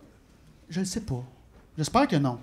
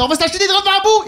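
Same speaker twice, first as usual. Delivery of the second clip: fast, high, loud yelling; screaming inner voice; 13 semitones higher, 6 dB louder, faster than usual. Acted. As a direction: shriek the demand in a rush. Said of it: "Elle me crie dans la tête".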